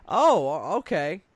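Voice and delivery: loud startled oh. Real, not acted. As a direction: jump at the sight. Startled by the creature on screen.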